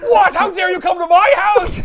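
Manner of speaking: put-on high, loud old-lady shriek; hammed-up Aunt May throwing him out; outraged Aunt May. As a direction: screech like an offended old aunt. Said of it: "Aunt May reacts".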